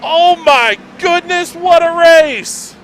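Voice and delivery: high, loud, stretched disbelief; ecstatic finish call. Real, not acted. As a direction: shout the finish in awe. Photo finish reaction.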